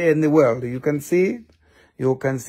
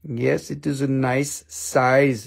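ordinary talk

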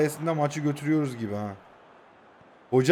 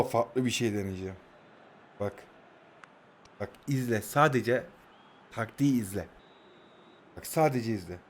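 hushed; 7 semitones lower, 10 dB quieter than usual. Real, not acted. Hushed before trying new tactic.

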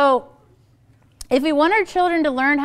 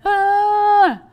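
put-on higher, louder frustrated child's "ah"; whiny child's wail; 9 semitones higher, 8 dB louder than usual. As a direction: let out a kid's fed-up whine. Child whining in the car.

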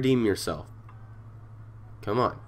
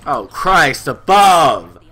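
slow, loud startled cry; drawn-out exclamation; 5 semitones higher, 10 dB louder, much slower than usual. Real, not acted. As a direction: stretch out the shock.

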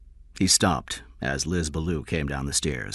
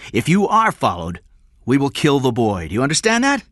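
loud, raised, forceful orders; threatening command; 7 semitones higher, 6 dB louder than usual. Acted. Kidnapper warning King against being followed, threatening command. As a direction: dictate terms with menace.

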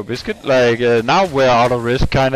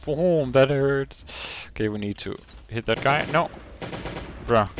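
usual speaking voice

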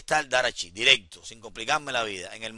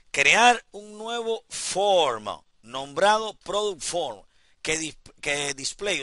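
slow, higher reading-out of an instruction; lectura de consigna; 4 semitones higher, 5 dB louder, much slower than usual. Real, not acted. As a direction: read the task out slowly, stretching each word. Lee la consigna en voz alta. (leyendo la consigna en voz alta, despacio)

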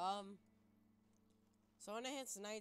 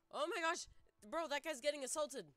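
loud, high, alarmed burst; alarmed outburst; 8 semitones higher, 7 dB louder than usual. Real, not acted. Gamer watching a prison fight, loud alarmed. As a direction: shout it out in shock. Player sees inmate being attacked.

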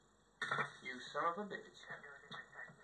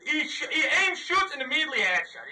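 loud, high-pitched exasperated rant with laughs; 9 semitones higher, 11 dB louder than usual. Real, not acted. Player ranting loudly to his team about dying. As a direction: vent loudly about the lucky headshot. Frustrated rant after death.

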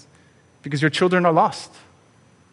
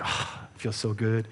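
soft, content "Ah, it feels so good", much softer and lower; contented sigh; 4 semitones lower, 10 dB quieter than usual. Pretend. Imagined parent sighing softly over child's happy family. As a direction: sink into quiet satisfaction.

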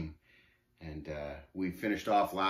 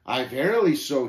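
louder, half-raised shout; 2 semitones higher, 7 dB louder than usual. A narrator's reading. Shame contradicting the pilgrim to his face, mild shout. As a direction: retort loudly but not at full shout. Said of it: "he shouted".

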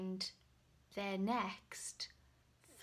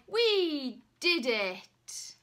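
triumphant; 4 semitones higher, 7 dB louder, much slower than usual. Acted. Knight celebrating victory.

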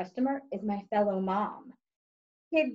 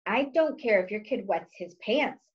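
usual speaking voice

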